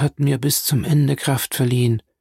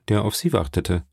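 ordinary delivery, nothing marked

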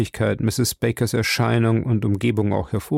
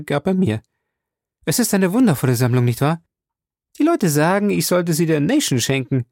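raised, eager lady's voice; proud Mrs Baker; 6 semitones higher than usual. A narrator's reading. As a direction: show off the collection.

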